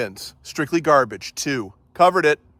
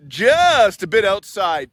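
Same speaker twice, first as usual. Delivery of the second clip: slow, loud announcer drawl; deadpan baseball announcer; Bob Uecker impression; 5 semitones higher, 6 dB louder, slower than usual. Bob Uecker movie line imitated.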